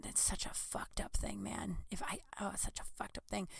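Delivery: hushed whisper, barely voiced. Real, not acted. To the viewers about election news, whispered. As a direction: drop to a whisper for the aside.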